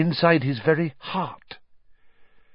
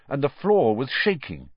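plain reading voice

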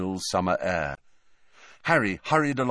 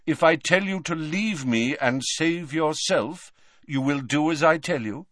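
higher, insistent questioning; Dumbledore's voice; 6 semitones higher than usual. A narrator's reading. Headmaster making his pupil promise to flee, gravely insistent. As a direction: press for a promise, phrase by phrase.

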